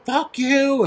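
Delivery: woman exploding at the firefighter, imitated; mock blow-up. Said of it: "she blew up".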